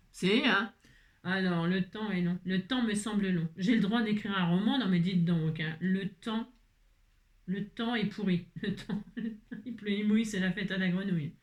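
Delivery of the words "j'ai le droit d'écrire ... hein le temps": ordinary delivery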